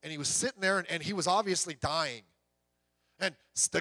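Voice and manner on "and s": ordinary talk, nothing marked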